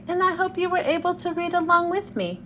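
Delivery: plain reading voice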